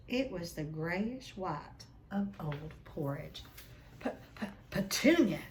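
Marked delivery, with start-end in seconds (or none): 4.0-5.4 gasping stammer. Acted. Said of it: "he gasped".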